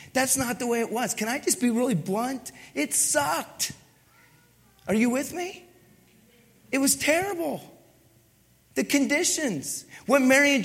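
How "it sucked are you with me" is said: ordinary talk, nothing marked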